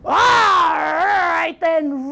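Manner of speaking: grudging, long-drawn troll bellow; high, slow roar of assent; troll's roar. Acted. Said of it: "roared the troll".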